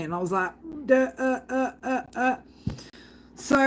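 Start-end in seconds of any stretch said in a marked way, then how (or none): 0.9-2.4 dumbfounded stammer. Retold or imitated. Dumbstruck when asked to read.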